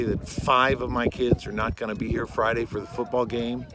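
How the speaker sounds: frustrated teacher venting; exasperated rant. Real, not acted. Ranting about uncommitted band kids.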